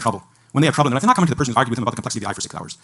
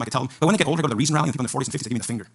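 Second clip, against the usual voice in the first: ordinary talk, nothing marked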